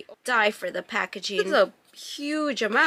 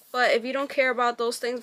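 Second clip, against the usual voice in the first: normal talking voice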